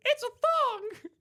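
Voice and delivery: high slow puppet squeal; Pinocchio voice. Retold or imitated.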